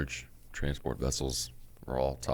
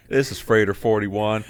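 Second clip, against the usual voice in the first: slow, louder radio call voice; over walkie talkie; 5 semitones higher, 9 dB louder than usual. Pretend. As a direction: announce yourself on the CB. Mock ship radio call.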